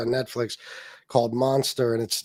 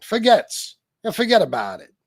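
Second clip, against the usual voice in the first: a light New York accent on "Forgets", the first syllable popped high; lightly voiced New York wiseguy saying "forget about it"; a hint of New York mobster; 6 semitones higher, 4 dB louder than usual. Shown as a demonstration. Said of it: "with a New York accent".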